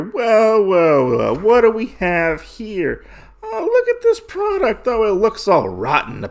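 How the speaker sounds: high, mocking thug voice, a bit far from his own; skinny thug voice. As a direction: sneer like a skinny street thug. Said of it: "or the skinny guy says".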